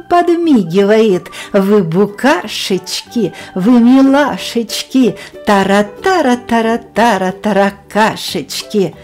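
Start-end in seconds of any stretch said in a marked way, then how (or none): none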